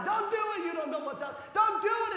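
high, loud panicked cry; frantic yell. Retold or imitated. Disciples holding Peter's belt, panicked yelling. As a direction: yell to stop him.

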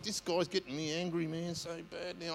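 softly muttered angry thought; quiet inner grumble. Retold or imitated. Preacher recalling his own thought in the car, soft muttered grumble. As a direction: grumble it under your breath.

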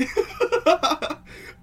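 high airy laugh; high breathy laugh. Real, not acted. Laughs after story interrupted.

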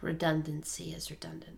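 breathy deadpan whisper. Pretend. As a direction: whisper it as a dry aside. Deadpan joke aside.

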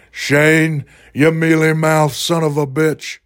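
terrible Stone Cold. Acted. Fan mimics wrestler insulting Shane, hammed-up. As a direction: stretch the insult in a mock wrestler voice. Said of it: "That's my terrible Stone Cold".

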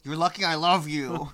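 loud, slow mock threat; loving exasperation. Real, not acted. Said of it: "talk more into the microphone".

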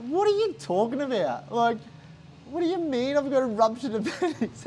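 high-pitched, disbelieving question; incredulous. Retold or imitated. Patient questioning doctor's ruptured appendix diagnosis, incredulous. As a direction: retell stunned disbelief with a laugh breaking in.

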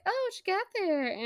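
high-pitched, playful character voice; light high voice, curious; acted Maggie quote. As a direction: lift it high as a nosy girl asking. Voicing Maggie in story.

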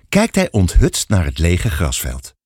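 usual speaking voice

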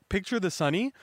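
usual speaking voice

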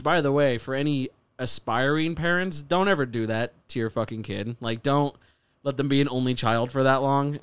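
ordinary talk, nothing marked

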